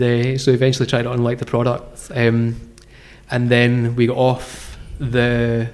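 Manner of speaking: normal talking voice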